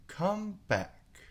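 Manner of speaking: high calling voice; calling after. A narrator's reading. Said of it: "the caterpillar called after her".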